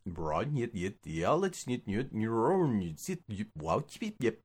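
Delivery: nonsense syllables like reversed tape; mock backwards speech. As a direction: pretend you're talking in reverse. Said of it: "It wasn't talking backwards".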